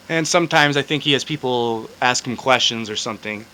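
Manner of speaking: normal talking voice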